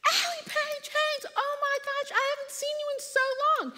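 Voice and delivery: very high, loud squealing greeting; gushing cashier. Retold or imitated. Cashier spotting an old schoolmate, high thrilled squeal. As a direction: shriek with delight at seeing an old friend.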